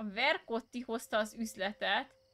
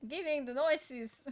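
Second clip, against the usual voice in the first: British chef voice; 3 semitones higher, 4 dB louder than usual. Retold or imitated. Continued chef impression.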